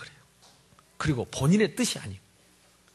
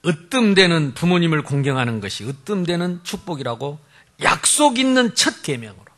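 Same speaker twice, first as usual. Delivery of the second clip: ordinary delivery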